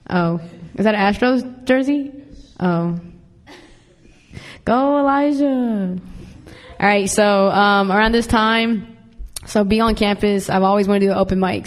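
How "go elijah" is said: high, drawn-out cheer. Real, not acted. Host cheers on an audience member, high drawn-out cheer. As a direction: call the name slowly in a raised voice.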